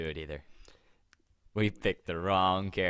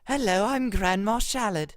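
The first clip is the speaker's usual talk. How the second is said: put-on high old British lady voice; Grandma Shallard; 14 semitones higher, 6 dB louder than usual. Trying out the grandmother's voice, old British lady. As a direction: put on a sing-out elderly lady greeting. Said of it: "do the Miss Shallard voice".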